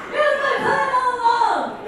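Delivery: high, loud gloating schemer; playfully triumphant evil-mastermind voice; mock villain. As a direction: crow it like a cartoon villain, in jest. Joking villain gloat, then "just kidding.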